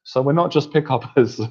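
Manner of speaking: usual speaking voice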